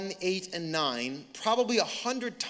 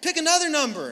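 higher, eager daughter voice; imitated daughter; 7 semitones higher, 4 dB louder, faster than usual. Daughter pushes tired Dad for more, imitated high voice. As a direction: mimic a persistent daughter. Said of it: "she's like".

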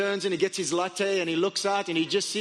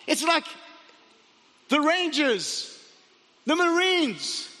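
high, frantic listing of the threat; hammed-up alarm, each phrase cried out; panicked; 5 semitones higher than usual. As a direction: report the enemy in mock terror. Gehazi keeps panicking about the army.

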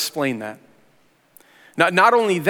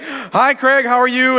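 higher, bright eager greeting; flustered hello; 8 semitones higher, 3 dB louder, slower than usual. Retold or imitated. Caught off guard by Craig's call, eager greeting. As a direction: cover the surprise with a cheery hello.

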